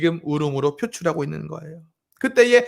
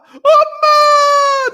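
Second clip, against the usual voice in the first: much higher, long drawn-out wail; imitating a wailing child; 14 dB louder, much slower than usual. Daughter ignoring dad's comfort, wailing drawn out for mom. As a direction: stretch out a crying child's wail. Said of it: "눈을 꽉 감고 울고 있는 거예요".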